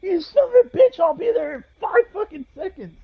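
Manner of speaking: high, strained mock yell down a hallway; retold yelling. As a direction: threaten someone far off in a high voice. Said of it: "I was yelling out the fucking open goddamn door".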